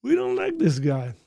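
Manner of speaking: high, loud mock rejection; hammed-up complaint, starting high and easing down; disgruntled subscriber. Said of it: "Somebody subscribed and they were like".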